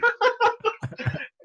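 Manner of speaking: put-on very high childlike giggle. Dad imitating his toddler's giggle after the prank, high giggle.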